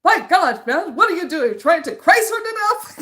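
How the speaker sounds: mock melodramatic shout; hammed-up Van Helsing. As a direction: overact the shocked hero. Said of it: "And he goes".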